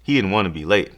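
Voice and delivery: usual speaking voice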